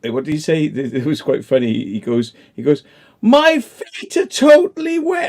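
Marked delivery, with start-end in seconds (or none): 3.2-5.3 high, slow, loud mimicked wail; mock screaming. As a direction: imitate a friend shrieking about wet feet. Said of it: "screaming at the top of his voice".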